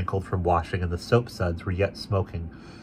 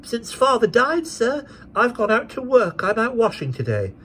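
put-on young girl; 3 dB louder than usual. A narrator's reading. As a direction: explain earnestly like a child. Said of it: "said the child".

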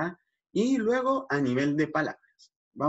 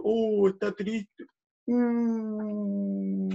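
tristeza empática; 6 semitones higher, much slower than usual. Pretend. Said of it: "la niña está triste".

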